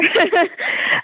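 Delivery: real crying laugh, choked up; tearful laugh. Real, not acted. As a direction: laugh that breaks into a sob. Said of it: "You're gonna hear it".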